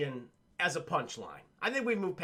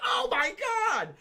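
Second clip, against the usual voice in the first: high, hammed-up shout of horror; mock scream; 8 semitones higher than usual. Arnold reacting to a spear in his chest, mock scream. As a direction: imitate a bad actor's agonized cry.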